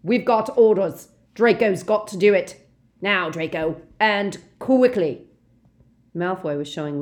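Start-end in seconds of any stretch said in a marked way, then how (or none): 0.0-5.3 loud barked commands; harsh loud henchman; brutal order. Acted. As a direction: drive Draco on. Brutal Death Eater giving orders.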